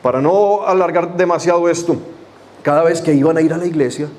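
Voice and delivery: ordinary delivery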